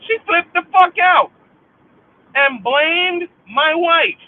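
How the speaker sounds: high, loud, slowing on blamed my wife; angry shout. Real, not acted. Venting at the sister's betrayal, furious shout. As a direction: hammer each word in rage.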